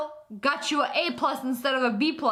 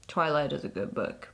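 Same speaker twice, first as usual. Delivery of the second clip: low possessed plea; 7 semitones lower than usual. Acted.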